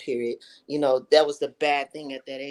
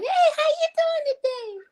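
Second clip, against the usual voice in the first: much higher, loud squeaky greeting; childhood high voice; 5 dB louder than usual. Retold or imitated. Boy answering how he's doing, very high and loud. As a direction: chirp it like a small boy. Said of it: "I have a high tone voice".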